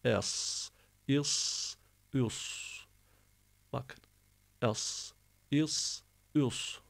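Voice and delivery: "Es, is, üs" with long heavy S endings; snake-like hiss. Shown as a demonstration. Teacher asking students to copy, hissing "Es, is, üs". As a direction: hiss each final S like a snake. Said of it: "yılanın tıslaması gibi adeta".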